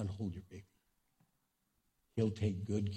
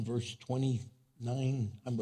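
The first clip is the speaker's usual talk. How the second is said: ordinary delivery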